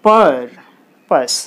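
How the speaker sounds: పర్ long and forceful, పస్ short; stress on first syllable. Shown as a demonstration. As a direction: lean on పర్, pause, then a brief పస్. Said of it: "stress అంటే ఫోర్స్గా".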